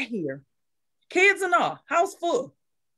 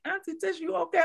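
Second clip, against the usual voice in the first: lightly voiced small child, higher; a child's worried question; kids asking; 10 semitones higher, 4 dB quieter than usual. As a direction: kids checking on their auntie in a light child voice. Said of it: "the kids was just like".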